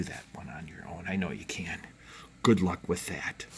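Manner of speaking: soft whispered encouragement; a gentle whispered good luck. Real, not acted. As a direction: whisper it like a secret cheer for the class. Teacher encouraging students softly.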